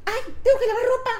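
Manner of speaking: high, startled cry of remembering; sudden remembering. Retold or imitated. Recalling a chore mid-task, a mock startled high cry. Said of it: "Y entonces digo".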